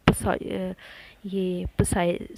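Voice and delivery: "पसाई" with a p before the s, pa-sai; P sounded. Shown as a demonstration. Teacher showing the wrong psychology, p sounded in "पसाई". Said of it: "साइकोलॉजी नहीं बोला हमने".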